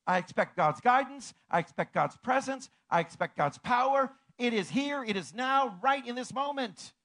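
loud, high, rising proclamation of faith; fervent preacher's cry; preaching at full force. Acted. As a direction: declare it to the congregation with fire.

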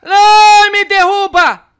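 very high loud scream. Real, not acted. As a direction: scream as you get knocked down. Knocked over, screaming.

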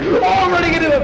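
loud panicked laugh; excited shout. Real, not acted. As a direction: yell and burst out laughing. Running into enemy.

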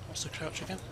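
normal talking voice

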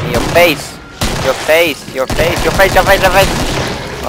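gloating battle cry; taunting shout. Real, not acted. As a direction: yell in your face at the zombies, rising. Taunting zombies while shooting.

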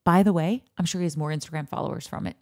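ordinary talk

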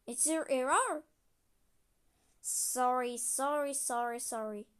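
ordinary delivery

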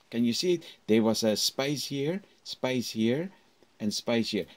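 ordinary talk

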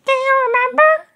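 very high, quick voice of the mother goose; a light, high motherly goose. A narrator's reading. Mother goose gently answers her little son, high and quick.

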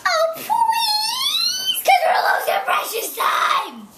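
high pleading shout; dramatic begging. Real, not acted. Kid begging mom dramatically.

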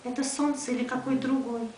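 measured poem recitation, endings dropping away; poetry reading. Acted. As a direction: address it as verse, pausing after each phrase. Reads another poem in Russian. (размеренная декламация стихов)

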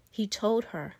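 plain reading voice

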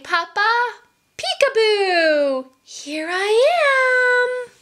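a hint of a happy toddler; baby voice. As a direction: call out like a delighted baby. Baby character speaking.

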